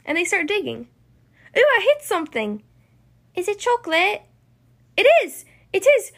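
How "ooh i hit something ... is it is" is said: high, excited exclamations; thrilled discovery. Acted. Sisters digging up something they hope is chocolate, thrilled. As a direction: build to loud, high squeals of excitement.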